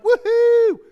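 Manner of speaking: high, loud whoop of joy; mock cheer. Patient celebrating clean bill of health, hammed-up whoop. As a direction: whoop like you just got good news.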